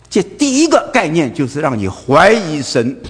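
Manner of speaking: normal talking voice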